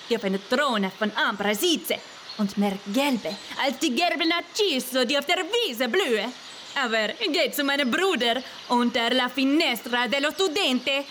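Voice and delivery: a fumbling Italian bush, half laughing; Italian accent with hesitations; Italian-accented rose bush. A narrator's reading.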